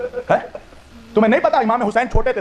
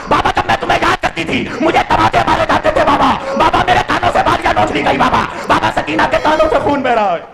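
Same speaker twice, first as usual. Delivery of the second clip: very high, loud sobbing plea; slow heartbroken wail; child's wailing lament; 8 semitones higher, 4 dB louder than usual. Acted. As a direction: cry to the father like a bereaved little girl. Sakina wails over father's head.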